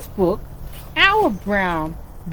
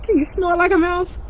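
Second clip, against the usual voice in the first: lively storybook reading for little ones; animated picture-book narration; reading to children; 4 semitones higher than usual. A narrator's reading. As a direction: read it like storytime with kids.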